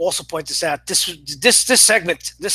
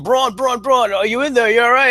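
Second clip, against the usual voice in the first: higher, louder calls for Braun; yelling through the door; 5 semitones higher, 3 dB louder than usual. Retold or imitated. Wrestlers banging on the ambulance, calling to Braun in a mock yell. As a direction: call out to someone trapped inside.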